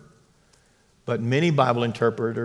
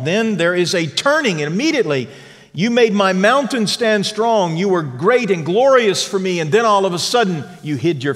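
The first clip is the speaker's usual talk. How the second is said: raised, ringing sermon voice; fervent preaching; 7 semitones higher, 6 dB louder than usual. Acted. Pastor preaching hope to his church, fervent and raised.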